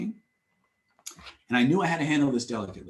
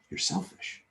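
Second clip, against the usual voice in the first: breathy whisper, softer; whispered verdict; 10 dB quieter than usual. Retold or imitated. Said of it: "I said".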